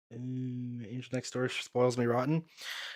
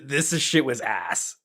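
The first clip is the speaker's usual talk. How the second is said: ordinary talk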